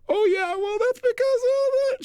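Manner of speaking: high, slow tearful whimper; crying again. Retold or imitated. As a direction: let the tears take over the words. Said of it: "She started crying again".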